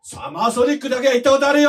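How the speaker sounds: high shouted comeback; mock indignation. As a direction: shout back defensively, for comedy. Shouting back in mock quarrel. (ムキになって叫び返す)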